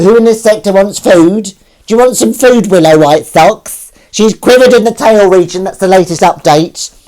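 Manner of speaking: high, loud pet-calling announcement; mock cat bulletin. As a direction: proclaim it loudly to the cat like a bulletin. Announces food and updates to cat.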